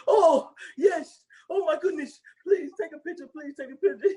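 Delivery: high, laughing fan squeal; excited fan voice. Retold or imitated. Retelling the bathroom scene to a friend, high gushing fan voice. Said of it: "he was like".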